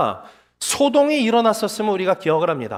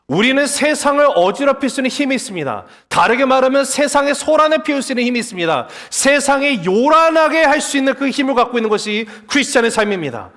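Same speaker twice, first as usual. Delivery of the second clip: higher-pitched, emphatic sermon delivery; forceful preaching; 5 semitones higher, 3 dB louder than usual. Real, not acted. Preacher telling believers they can stir up the world, forceful and high. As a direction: proclaim it to the whole congregation.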